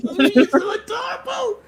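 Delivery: put-on very high cooing baby talk; squealing baby talk aunt. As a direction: gush like an aunt pinching cheeks. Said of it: "pinch your little cheeks like".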